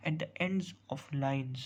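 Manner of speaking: slow, deliberate reading of a definition; teacher dictating a definition slowly. Shown as a demonstration. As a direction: spell the rule out word by word, very slowly. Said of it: "reads definition out slowly".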